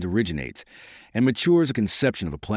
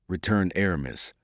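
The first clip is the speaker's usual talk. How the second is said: ordinary delivery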